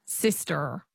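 "Sister" with an American final R; American rhotic R. Shown as a demonstration. Pupil echoes the coach, rhotic "Sister". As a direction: copy American "Sister", R sounded. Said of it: "So that's the American".

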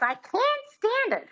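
can't squealed very high with a flat a; squeaky Lena Lamont. Retold or imitated. Lena Lamont repeating the coach in a high squeal. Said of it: "And she goes".

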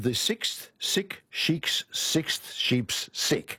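very slow, careful articulation; slow tongue twister. Shown as a demonstration. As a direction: say each word slowly and clearly. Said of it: "I can't do it fast".

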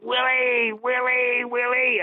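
calling out. Retold or imitated. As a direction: sing out Willie from the seats to the stage. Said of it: "I was singing out".

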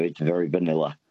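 usual speaking voice

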